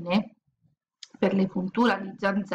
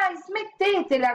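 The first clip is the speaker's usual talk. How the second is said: high yelp of a shout; 11 semitones higher than usual. Acted. Girl shouting at the mosquitoes, high yelp. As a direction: cry out at the swarm. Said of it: "gridò Zaccarina".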